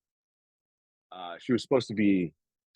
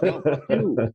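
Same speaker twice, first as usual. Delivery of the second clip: louder and higher, laughing through it; laughing mock defiance; 8 semitones higher, 6 dB louder, slower than usual. Dad mimics his quarrel with his daughter, laughing. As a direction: fire it back, cracking up. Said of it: "I'm like".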